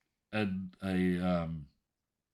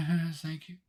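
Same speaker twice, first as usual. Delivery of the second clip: sheepish Epimetheus; 7 semitones higher than usual. Acted. Epimetheus answers Hope sheepishly.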